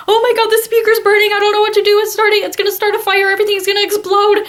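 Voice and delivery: high, fast, panicked near-wail; panicked crying. Retold or imitated. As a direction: rush it out like the room is on fire, close to tears.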